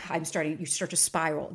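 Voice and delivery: ordinary delivery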